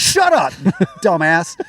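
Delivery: high, laughing brush-off; playfully telling a friend to shut up. Retold or imitated. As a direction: swat the joke away laughing. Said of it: "I'm like".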